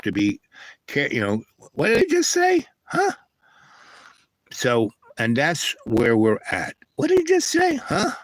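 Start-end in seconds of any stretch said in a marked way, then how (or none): 1.7-3.1 very high squeaky put-on voice; baffled little high-pitched "huh?"; puzzled double-take. Acted puzzled reaction to cheeky monkeys. 7.0-8.1 very high squeaky put-on voice; baffled little high-pitched "huh?"; puzzled double-take. Repeats the puzzled reaction.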